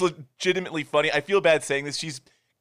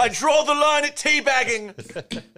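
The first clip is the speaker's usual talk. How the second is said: put-on slow, high, clumsy British accent; a wobbly British accent, drawn out; bad British accent; 10 semitones higher, 4 dB louder, slower than usual. Quoting the movie line in a wrong British accent. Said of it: "I'm not doing the right accent".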